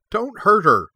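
high, loud, urgent plea; pleading. A narrator's reading. Cora begs guards ready to attack the puppy, pleading. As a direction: plead urgently, pitched far up. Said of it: "Cora pleaded".

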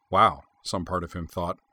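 ordinary delivery, nothing marked

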